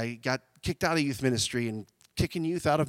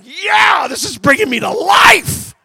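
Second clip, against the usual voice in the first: hammed-up shouting joy, much higher and louder; excited whoop; 13 dB louder, much slower than usual. Retelling his joy at office moves, mock ecstatic shout. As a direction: whoop it up like a kid at a party. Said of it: "And I'm like".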